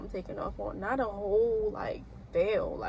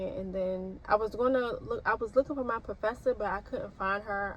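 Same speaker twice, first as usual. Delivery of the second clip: usual speaking voice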